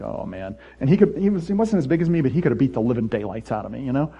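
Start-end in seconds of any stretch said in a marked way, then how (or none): 0.0-0.6 much lower, very soft; a hint of nervous dread; muttered dread. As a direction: dread under your breath. Retold dread.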